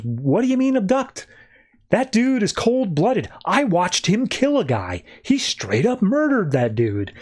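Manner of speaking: high, drawn-out incredulous protest; indignant retelling. Retold or imitated. Tied-up Girder accusing Henry to Barry, mock indignant.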